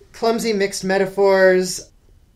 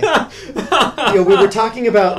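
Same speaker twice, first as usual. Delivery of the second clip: laughing; 5 semitones higher, 5 dB louder than usual. Real, not acted. Laughing at flat prose.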